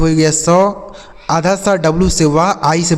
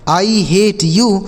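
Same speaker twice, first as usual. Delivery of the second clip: each word said very slowly and clearly; slow clear English; 2 semitones higher, much slower than usual. Shown as a demonstration. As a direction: dictate it slowly for learners. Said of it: "जैसे". (बहुत धीरे, साफ़ अंग्रेज़ी उच्चारण)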